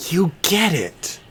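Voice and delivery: high, slow excited cheer. Acted.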